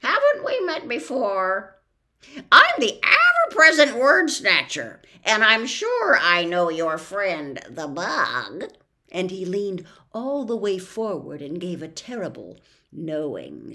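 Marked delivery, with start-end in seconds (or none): 0.0-8.7 boastful bird. Acted. The word snatcher introduces himself, loud and proud. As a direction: crow about your job.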